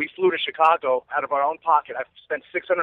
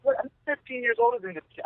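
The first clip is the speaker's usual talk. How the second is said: much higher, softer astonished aside; incredulous inner voice; 9 semitones higher, 5 dB quieter than usual. Retold or imitated. Fan after Curry's high five, giddy disbelief. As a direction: replay your own starstruck disbelief.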